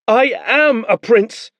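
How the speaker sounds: high, loud, slow insistence; defiant boy. Acted. Boy insists on his rank to the soldiers. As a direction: stamp each word.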